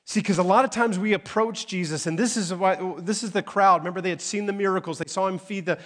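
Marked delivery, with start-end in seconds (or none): none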